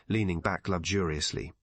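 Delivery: ordinary delivery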